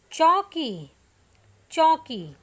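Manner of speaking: teacher projecting चौकी for the class; loud clear word. Shown as a demonstration. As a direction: call out चौकी loud for pupils to repeat. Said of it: "ऊंची आवाज़ में".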